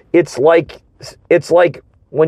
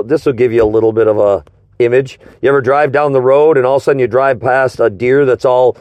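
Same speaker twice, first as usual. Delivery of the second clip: ordinary talk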